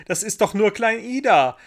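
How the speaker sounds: high, loud, amused exclamation; laughing boy. A narrator's reading. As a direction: call it out with glee. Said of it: "lachte Michel".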